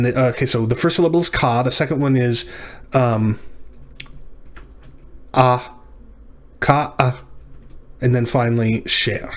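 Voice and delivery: normal talking voice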